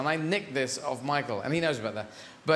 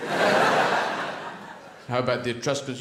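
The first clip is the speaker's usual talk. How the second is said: slow mock librarian; 6 semitones lower, much slower than usual.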